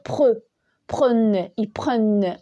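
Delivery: PRENNENT mispronounced as "preune"; wrong vowel. Shown as a demonstration. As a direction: say PRE and PRENNENT with an "eu" instead of open è. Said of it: "On sera obligé de dire".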